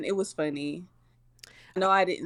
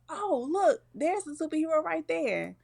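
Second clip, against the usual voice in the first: higher, eager mock gushing; excited pointing; 9 semitones higher than usual. Classmates spotting the superhero, eager higher voice. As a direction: point it out like a starstruck kid. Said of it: "it was like".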